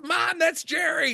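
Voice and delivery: high, drawn-out mock shout; slow, very high retold outburst; exasperated excited daughter. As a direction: imitate a daughter crying out to mom.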